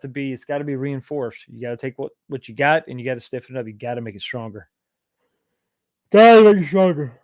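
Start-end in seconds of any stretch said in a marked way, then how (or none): 6.1-7.2 loud, high, forceful repeat; emphatic shout. Shown as a demonstration. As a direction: belt it out for emphasis.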